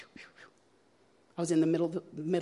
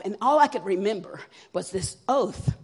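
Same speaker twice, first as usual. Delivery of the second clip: ordinary talk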